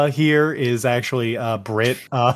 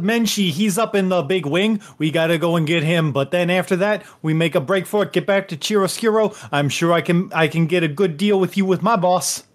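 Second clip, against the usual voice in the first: higher, quick Canadian buddy voice with a friendly "bud"; Canadian-ish accent; 6 semitones higher, faster than usual. Acted. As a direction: play a chummy Canadian hoser, a bit higher and fast. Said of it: "I can only imagine Ricky with that accent".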